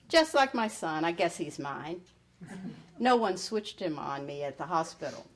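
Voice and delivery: usual speaking voice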